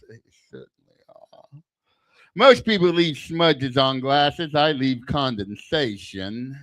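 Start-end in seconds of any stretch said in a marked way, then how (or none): 0.1-1.6 whispered echo. Acted. Echoed aside after joke.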